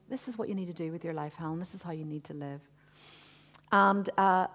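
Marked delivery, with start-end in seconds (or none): 0.1-2.6 soft, gentle and hushed, fading away; gently saying. Retold or imitated. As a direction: speak it like a tender, quiet word from God. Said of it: "just gently saying".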